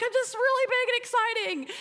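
a huge angel who knows he's exciting; giddy angel voice. Retold or imitated. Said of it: "'cause he's just like".

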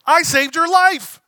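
high, loud and slow, indignant; Mister Incredible shouting at the lawyer, high and loud; exasperated shout. Retold or imitated. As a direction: yell it, outraged at the ingratitude. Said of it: "Mister Incredible's like".

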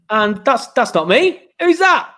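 rising surprised disbelief; a real double take, voice climbing. Real, not acted. Surprised at wrong picture.

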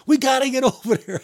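high mock insisting officer. Officer insists the rookie move toward the fire, high and mock.

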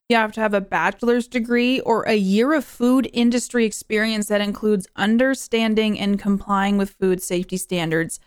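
usual speaking voice

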